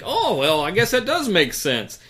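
mocking a clueless co-host, high and loud; hammed-up guy. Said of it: "He's like".

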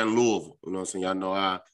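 normal talking voice

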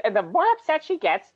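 each phrase pushed up into a shrill register; rising shrill pitch. Shown as a demonstration. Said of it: "the higher and more shrill it gets".

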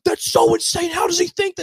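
high, loud exasperated yell at the producer; retold yelling. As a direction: shout it up high in disbelief. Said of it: "And I'm, like, yelling".

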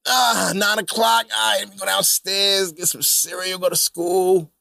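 put-on sleepy kid. Kid waking up for online school.

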